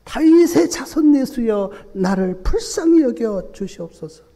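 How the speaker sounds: high, loud desperate plea; the blind beggar shouting over the crowd to Jesus; loud cry. Retold or imitated. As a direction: cry out for mercy with everything. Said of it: "더욱 크게 소리를 지르면서".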